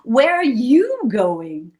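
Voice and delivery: slow, drawn-out question; purring lion. A narrator's reading. Lion asks Little Red where she's going, purring. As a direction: purr the question out slowly. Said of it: "purred the lion".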